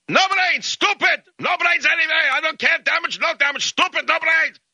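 very high-pitched mock Russian yelling; screaming crazed Russian. Crazed Russian berates the man parked against his bumper, screaming. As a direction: rant in a frantic, high imitation of an angry Russian. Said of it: "the Russian gets down on his knees".

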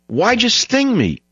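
high, slow, pleading cry of betrayal; the stung frog, shocked and hurt; very high, stretched-out wail of why; dying frog's plea. Acted. Dying frog asks scorpion.

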